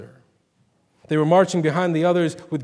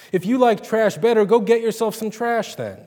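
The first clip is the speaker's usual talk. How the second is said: higher, louder angry railing; 6 semitones higher, 5 dB louder than usual. A narrator's reading. Ruby rails at God over the hog pen, higher and louder. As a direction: throw the words up at heaven. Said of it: "she railed".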